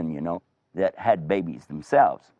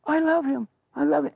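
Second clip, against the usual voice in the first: high-pitched, gushing adoration; put-on high voice, thrilled; overjoyed woman. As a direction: squeal with delight over a baby. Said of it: "she was so happy".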